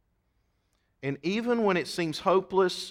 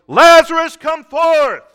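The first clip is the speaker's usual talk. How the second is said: Lazarus, come forth! shouted much higher and louder; loud cry; 13 semitones higher, 17 dB louder, slower than usual. Acted. Jesus calling dead Lazarus out of the grave, full shout. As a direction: call the dead man out of the tomb at full voice. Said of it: "he cried with a loud voice".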